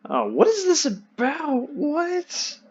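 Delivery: high, fast, incredulous sputter; can't believe what he's hearing; baffled. Real, not acted. To friend about space science, high baffled disbelief.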